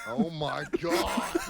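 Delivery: slow oh my god, dissolving into laughter; laughing. Real, not acted. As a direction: crack up loud mid-phrase. Laughing hard at the game.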